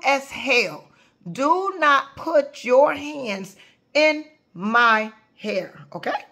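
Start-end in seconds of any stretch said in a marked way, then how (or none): 1.3-6.2 each word spaced and pressed out slowly. Real, not acted. Speaker warning the audience, slow and deliberate. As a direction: lay it down slow so nobody misses it. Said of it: "I have to say it like this".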